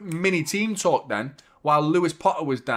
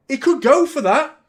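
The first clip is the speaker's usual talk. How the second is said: high, loud rising alarm; 10 semitones higher, 7 dB louder than usual. Real, not acted. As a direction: sound worried he could be sent off. Fearing a red card.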